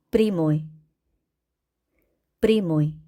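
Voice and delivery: 프리모이 with ya said as i; unstressed ya as i. Shown as a demonstration. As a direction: say 프리모이, stress on the o. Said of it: "강세 앞에 야는 이로".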